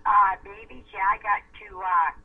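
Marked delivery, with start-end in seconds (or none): none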